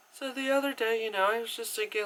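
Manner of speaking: lightly put-on young heroine's voiceover; narration voiceover. Reviewer imitates Gretel narrating the film, high soft voiceover. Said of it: "narration voiceover kind of stuff".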